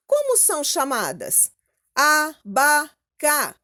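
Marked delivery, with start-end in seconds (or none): 1.9-3.5 "Abaca" slowly in three pieces, a-ba-ca; separated syllables. Shown as a demonstration. Teacher points out the other syllables, "Abaca" split slowly and loudly. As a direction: say a, ba, ca one by one, firm and louder. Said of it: "essas outras sílabas que não são tônicas".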